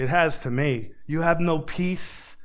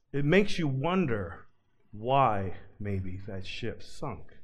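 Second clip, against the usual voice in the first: ordinary talk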